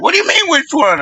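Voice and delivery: high-pitched retold astonishment; mock disbelief. Imitating cousins questioning him about the DVD, incredulous.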